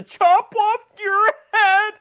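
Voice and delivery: high, strained juvenile mock threat; Travolta. Podcaster replaying a favorite movie line, hammed-up Travolta impression. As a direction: wail the threat, trying too hard to menace. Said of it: "I practiced it many times".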